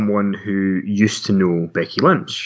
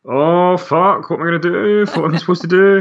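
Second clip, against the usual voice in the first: higher-pitched, hammed-up helpless dithering; mock panic; 7 semitones higher than usual. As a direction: play someone totally lost, pitched up. Imitating a lost, panicking wrestler.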